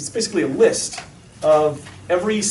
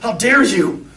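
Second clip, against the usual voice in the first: mock indignant scolding; 3 semitones higher, 5 dB louder, slower than usual. Imagined Jesus rebuking an exposed child, mock indignant. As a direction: pretend outrage, suddenly louder.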